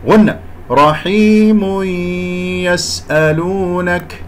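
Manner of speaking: رحيم يسألونك merged with ghunna; slow recitation. Shown as a demonstration. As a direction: join رحيم into يسألونك slowly. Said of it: "خطأ، هذه ياء غنة".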